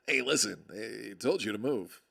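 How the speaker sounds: ordinary talk, nothing marked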